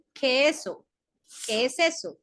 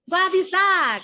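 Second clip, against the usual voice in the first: high, loud prying question; a gossip leaning in to pry; nosy gossip asking; 5 semitones higher, 6 dB louder, slower than usual. Acted. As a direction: ask it like a nosy busybody. Teacher acts gossip's question.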